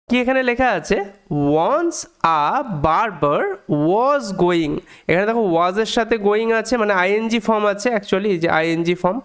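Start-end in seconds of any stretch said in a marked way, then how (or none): 1.5-4.8 very slow, word-by-word teacher reading; slow careful reading. Shown as a demonstration. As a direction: draw out each word for learners. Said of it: "আস্তে আস্তে শুরু করা যাক".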